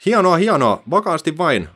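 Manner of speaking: loud, high, excited shout. A narrator's reading. Olga shouts encouragement as the bees carry the net. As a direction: cheer them on, loud and high. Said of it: "Olga huusi".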